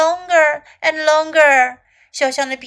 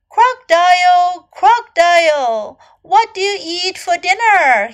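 higher, louder storybook call; 5 semitones higher, 7 dB louder than usual. A narrator's reading. Little elephant asks crocodile about his dinner, calling out. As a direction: call out brightly to someone at a distance.

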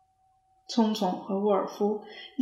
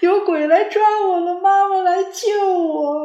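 loud, much higher sobbing plea; wailing cry; 10 semitones higher, 9 dB louder than usual. A narrator's reading. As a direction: cry out for mum. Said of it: "聪聪放声大哭". (放声大哭地喊)

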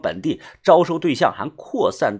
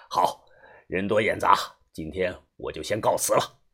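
low-voiced man reporting to a boss; softer, low, businesslike reassurance; middle-aged fixer, assured; 6 semitones lower, 3 dB quieter than usual. (中年男人低声笃定地汇报)

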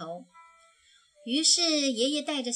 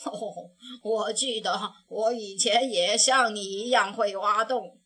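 sighing; 2 semitones lower, 4 dB louder than usual. A narrator's reading. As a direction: sigh gently over the past. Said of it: "他叹着气说". (熊爷爷叹着气回忆，低沉)

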